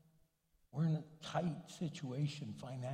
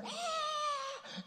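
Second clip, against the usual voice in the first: startled shriek. Retold or imitated. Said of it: "And Cindy's there".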